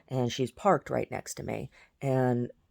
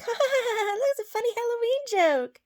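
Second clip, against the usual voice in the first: very high, squealing mock laughter; mocking imitation; 4 dB louder than usual. Imitating the woman laughing at the dead rat, mocking. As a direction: imitate her cackling at her own joke.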